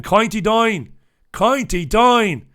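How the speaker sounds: put-on loud, high County Down accent. Host imitating a listener's home accent, hammed-up County Down accent. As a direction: put on a broad County Down twang, vowels turned to "coynty dine". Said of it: "All my accents sound the same".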